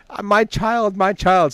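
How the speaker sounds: high, hammed-up wail of a distraught parent; mock wailing. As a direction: play the heartbroken parent, half joking. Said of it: "And I was in tears".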